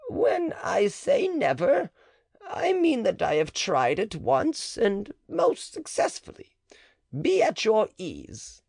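high, slow, reassuring; high-pitched coy midwife; the matron. Acted. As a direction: soothe him while backpedalling. Matron voiced in the dialogue.

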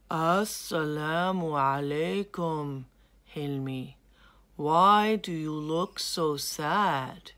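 a calm creature asking kindly; lightly voiced chameleon. Chameleon greets Hilmi.

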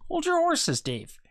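a hint of a wife reining him in; wife's voice. A narrator's reading. Wife calms angry husband.

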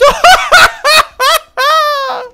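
loud, high-pitched cackle of glee; high giddy laugh. Real, not acted. Player delighted by his items, high giddy laugh. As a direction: squeal with laughter.

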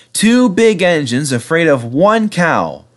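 high, slow gentle mockery; amused teasing. A narrator's reading. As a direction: draw it out to relish their embarrassment. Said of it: "chuckled Edward".

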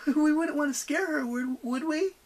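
high, put-on nervous laughing voice; forced laugh. A narrator's reading. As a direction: laugh falsely through the words. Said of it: "forced a laugh".

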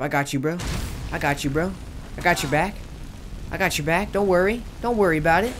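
loud, high buddy encouragement; hyped bro reassurance. Pretend. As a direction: hype up your buddy. Reassuring follower companion.

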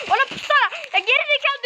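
angry gamer yelling; frustrated yell. Real, not acted. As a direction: snap at a careless teammate. Yelling at teammate.

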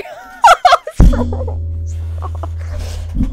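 high, breathy burst of laughter; cracking up hard; a shrieking real laugh out of nowhere; loud laugh. Real, not acted. Reacts to crushed Herobrine dream.

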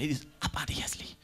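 hushed aside, breathy and unvoiced; whisper. Real, not acted. Comedian whispering a nasty aside to the audience. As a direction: lean in and whisper it like a secret. Whispered insult about a child.

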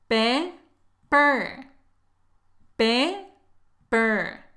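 "Pe" with open eh, "per" with r-coloured er; teacher splitting pepper into "Pe", pause, "per"; syllable breakdown. Shown as a demonstration. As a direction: sound out "Pe" and "per" separately. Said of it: "se pronuncian de forma diferente".

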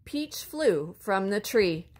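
usual speaking voice

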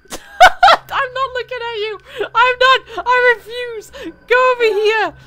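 high, loud, nervous laughing panic; scared laughing. Real, not acted. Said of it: "I'm so sc".

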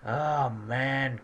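ordinary talk

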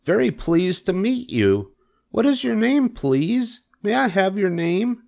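very high, eager pig voice; delighted Wilbur. Acted. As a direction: greet a new friend, high and drawn-out.

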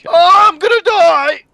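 slow, drawn-out high yell; loud, high cry of doom; panicked scream. Real, not acted. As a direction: wail loudly as the enemy closes in. Attacked in game.

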